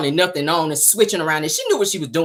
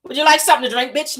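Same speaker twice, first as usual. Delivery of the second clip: Simon's voice; 5 semitones higher than usual. Retold or imitated. Mocking Simon offering Portia a drink. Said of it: "that's how he sound".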